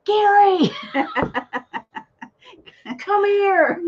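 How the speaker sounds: loud call to another room; yelling. Retold or imitated. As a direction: shout for him from afar. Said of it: "Pardon the yelling".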